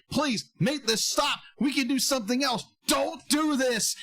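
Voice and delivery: mock desperate plea. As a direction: plead with father to stop. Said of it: "desperately pleading with his father".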